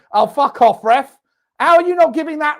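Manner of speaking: loud furious protest at the referee; angry at ref. Real, not acted. Fan raging at the referee, loud protest. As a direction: yell at the ref for not giving the foul.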